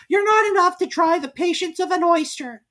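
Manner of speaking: high, loud snappish; snappy. Acted. Young crab snapping at her mother, high and loud. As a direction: snap back.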